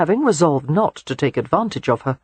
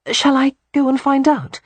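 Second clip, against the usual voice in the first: put-on higher, hopeful young woman's offer; eager young woman; 10 semitones higher, 3 dB louder than usual. A narrator's reading. Audrey begs to escape her grandfather-in-law, hopeful. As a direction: ask it hoping to get away. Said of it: "clearly desperate to escape".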